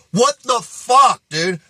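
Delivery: high, drawn-out incredulous outburst; outraged disbelief. Retold or imitated. Reacting to the song heard in a store, outraged disbelief. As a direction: stretch it out, baffled and indignant.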